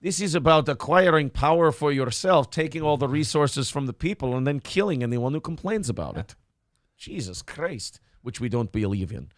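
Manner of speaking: a faint Scottish lilt creeping into a Russian villain; Scottish-tinged Lenin voice. Retold or imitated. Lenin lecturing a shocked activist, sliding into a Scottish accent. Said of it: "Why did I turn Scottish at the end?".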